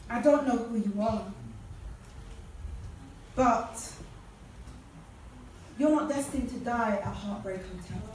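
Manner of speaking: soft, low, with long pauses between phrases; quiet, earnest pastoral reassurance; gentle appeal. Real, not acted. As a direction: bring it right down for an intimate word to one listener. Gentle personal appeal to listener.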